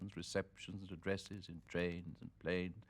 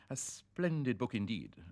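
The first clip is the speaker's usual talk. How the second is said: polite English gentleman; 6 semitones higher, 6 dB louder than usual. Retold or imitated. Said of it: "ventured Sir Hugh".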